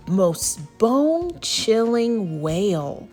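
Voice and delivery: slow, drawn-out eerie narration; spooky suspense. A narrator's reading. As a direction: stretch each word for suspense. Suspense before monster appears.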